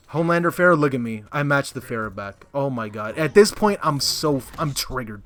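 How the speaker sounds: ordinary talk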